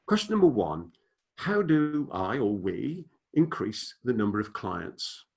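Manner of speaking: slow and deliberate, each word laid out; said slowly. Shown as a demonstration. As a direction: read the question slowly so it sinks in. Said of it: "and I'll say them slowly".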